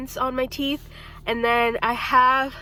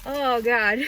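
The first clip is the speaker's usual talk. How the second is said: normal talking voice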